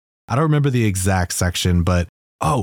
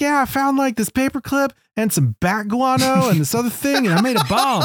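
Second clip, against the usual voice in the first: much higher, goofy comic-relief voice; Slick's voice. Retold or imitated. Retelling Slick's cave scene for the hosts, in a high goofy voice. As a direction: play the clueless sidekick bragging about his bomb. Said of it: "he's like".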